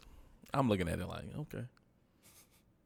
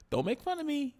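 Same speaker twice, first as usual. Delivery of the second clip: much higher mock whine; playful imitation of the wife; imitating Jada; 12 semitones higher than usual. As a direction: put on Jada's pleading voice. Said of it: "Then she said".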